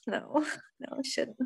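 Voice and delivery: shy away softly, with a little laugh; acted reluctance. Comic retells being told to do stand-up, softly reluctant. Acts out her reluctant reply.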